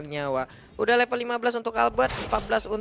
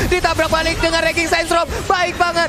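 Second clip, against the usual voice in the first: excited shout; 8 semitones higher, 6 dB louder than usual. Real, not acted. Teamfight erupts, caster yells.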